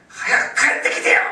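loud imitation of a wailing plea; tearful shout. Wife answering her missing husband, imitated tearful shout. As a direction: cry out in a hammed-up yell. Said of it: "思わず叫んで泣いたそうですね".

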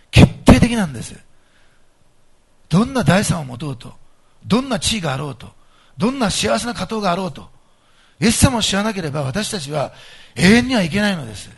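loud, rising sermon climax; forceful preaching. Acted. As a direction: preach it out with full conviction.

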